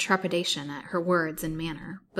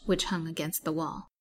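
plain reading voice

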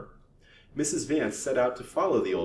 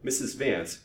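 ordinary delivery, nothing marked

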